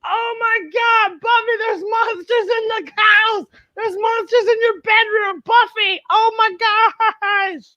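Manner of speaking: high, shouting alarm; panicked mom. Acted. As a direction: mom shrieks at monsters in the bedroom. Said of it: "Mom goes".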